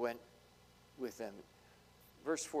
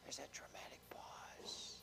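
whispered aside; 18 dB quieter than usual. Real, not acted. Aside about dramatic pause.

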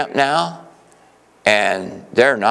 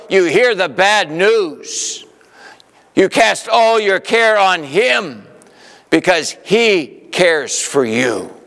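slow, higher comforting preaching; reassuring sermon; 7 semitones higher, slower than usual. Acted. Preacher comforting weary believers in a slow reassuring voice. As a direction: soothe the tired ones slowly.

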